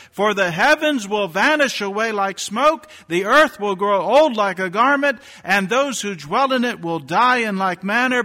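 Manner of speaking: high, loud scripture proclamation; preaching at full force. Acted. Preacher to his congregation, proclaiming scripture high and loud. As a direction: thunder the promise out over the pews.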